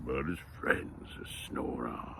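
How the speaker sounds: soft, slow, low near-whisper; hushed story ending. A narrator's reading. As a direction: let the tale drift softly to sleep.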